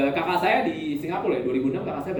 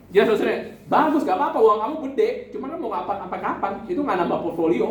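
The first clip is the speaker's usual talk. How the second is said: retold scolding; 7 semitones higher, 4 dB louder than usual. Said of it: "di Australia saya marahin".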